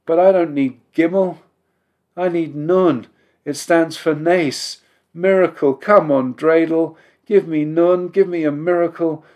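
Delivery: will the dreidel to land; eager pleading. A narrator's reading. Boy urging his dreidel.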